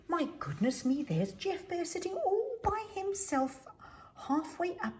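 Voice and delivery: high, surprised exclamation. A narrator's reading. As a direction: gasp it out in surprise.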